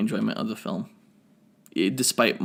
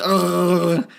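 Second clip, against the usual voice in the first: stabbing grunt; 7 semitones higher, 5 dB louder than usual. Retold or imitated. Imitating Wolverine stab grunt.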